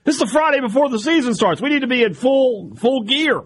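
mock frantic coach yelling at team; upset coach voice.

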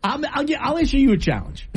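laughing. Real, not acted. Laughing after hearing her whistle.

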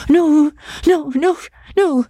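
high, pleading woman's voice, insistent; a frightened young woman refusing hard. A narrator's reading. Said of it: "she said vehemently".

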